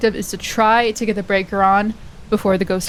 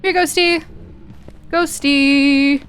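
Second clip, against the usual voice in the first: calling out; 6 semitones higher, much slower than usual. Real, not acted. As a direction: call the ghost over. Calling the ghost out loud.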